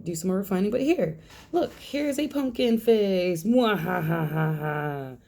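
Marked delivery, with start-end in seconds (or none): 3.4-5.2 slow evil cackle; mock villain laugh; mad scientist. Showing off finished pumpkin face.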